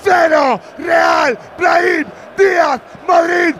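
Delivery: shouted slow goal recap; breakneck goal commentary. Real, not acted. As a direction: call the replay with fire. Shouted goal replay narration.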